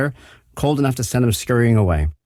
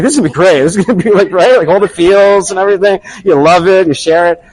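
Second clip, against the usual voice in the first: higher, louder hammed-up thrill; mock excitement; 7 semitones higher, 5 dB louder than usual. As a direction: ham up a dad who expects magic. Dad imagining viral moment.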